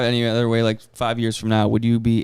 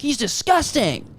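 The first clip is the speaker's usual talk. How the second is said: acted line; 12 semitones higher, slower than usual. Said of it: "I was like".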